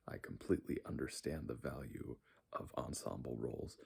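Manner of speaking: ordinary delivery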